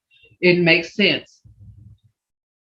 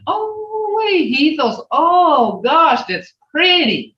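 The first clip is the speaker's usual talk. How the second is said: much higher, oh wee that's pretty; admiring exclamation; 8 semitones higher, much slower than usual. Shown as a demonstration. Teacher demonstrating delight at a child's find, high and gushing. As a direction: gush over something pretty.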